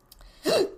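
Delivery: sudden high hiccup gasp; a sharp involuntary hiccup; loud hiccup. Real, not acted. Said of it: "God, I'm hiccuping".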